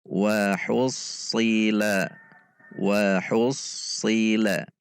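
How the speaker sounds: "Wa hussila" slow, with a thick emphatic shad; "Wa hussila" pelan dengan shad tebal; heavy shad demo. Shown as a demonstration. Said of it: "Kita bisa melatihnya".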